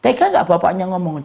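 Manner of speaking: normal talking voice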